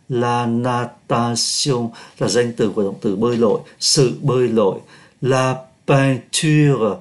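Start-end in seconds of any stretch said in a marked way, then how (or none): 5.2-7.0 "La peinture" very slow; drawn-out model reading of "La peinture"; slow French demo. Shown as a demonstration. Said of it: "O I N đọc là A". (đọc mẫu thật chậm "La peinture")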